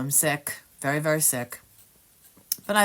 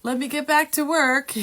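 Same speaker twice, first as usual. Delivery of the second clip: mock breezy; 11 semitones higher, 6 dB louder than usual. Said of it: "I'm just like".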